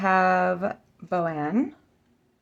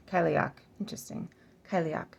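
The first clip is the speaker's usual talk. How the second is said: normal talking voice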